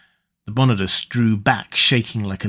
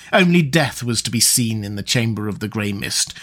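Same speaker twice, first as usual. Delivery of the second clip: ordinary delivery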